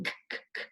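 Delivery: whispered k, k, k with no voice; k sound. Shown as a demonstration. Teacher sounding out the k sound for a child, unvoiced. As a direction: tap out the k sound three times, breath only. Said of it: "a C does make the K sound".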